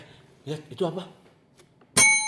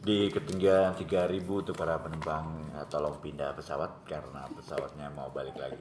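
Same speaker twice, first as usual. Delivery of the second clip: pilot announcement; 6 semitones lower, 9 dB quieter than usual. Pretend. Announcement skit.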